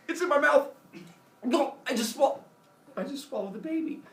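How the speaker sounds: high, halting stunned confession; mock shock. Kronos realising he swallowed the baby, mock-horrified high voice.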